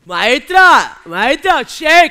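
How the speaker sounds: gritos cortos e insistentes; llamada a gritos. Acted. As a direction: interrumpe llamando fuerte una y otra vez. Espontáneo llama a la cómica. (el espontáneo grita para que la cómica le haga caso)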